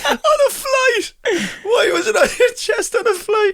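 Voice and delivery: high and slow, laughing; cracking up in disbelief; high incredulous laughing. Real, not acted.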